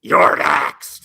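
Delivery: loud breathy callout; mock shout. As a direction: bark it like a wrestler's challenge. Mocking Goldberg's challenge.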